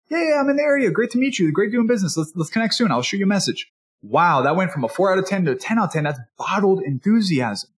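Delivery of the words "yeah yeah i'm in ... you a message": excited salesman. Retold or imitated. Salesman matching excited customer.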